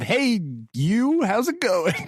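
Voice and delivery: fake friendly greeting. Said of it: "Did you pull out the old".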